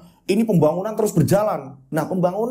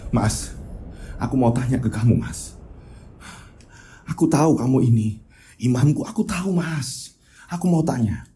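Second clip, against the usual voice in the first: lower, serious wife; a hint of a worried wife pressing her husband; sincere plea; earnest wife; 6 semitones lower than usual.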